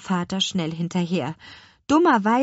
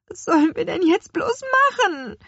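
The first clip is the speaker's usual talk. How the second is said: whining; 12 semitones higher, 4 dB louder than usual. A narrator's reading. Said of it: "jammerte Lea".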